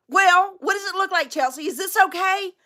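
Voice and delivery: high-pitched fussy husband imitation; mock fretting. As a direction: nag her for approval. Acted son pesters wife.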